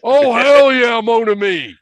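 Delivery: loud, high Cajun hell yeah; Stone Cold Cajun accent. Retold or imitated. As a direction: Cajun accent, shouted wrestler style. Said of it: "hear Stone Cold try to do a Cajun accent".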